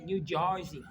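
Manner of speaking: "New Jersey" as Jersey locals say it, "Jersey" like "Charzey"; New Jersey accent. Shown as a demonstration. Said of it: "you say".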